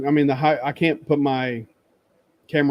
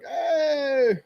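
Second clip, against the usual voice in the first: excited hey; 13 semitones higher than usual. Retold or imitated. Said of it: "I walk up and I was like".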